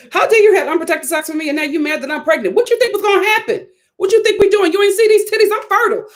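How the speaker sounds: fast, rising scolding like a wronged wife; mock outrage. Imagined furious wife.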